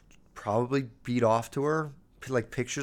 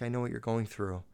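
soft, consoling teacher imitation; hushed sympathy; 3 semitones lower, 9 dB quieter than usual. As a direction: speak low-key, as if to a hurting child.

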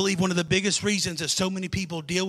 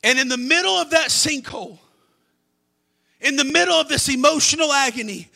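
fervent preaching; 4 semitones higher, 4 dB louder than usual. Acted. Preacher at full force.

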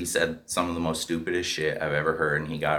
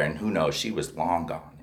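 ordinary delivery

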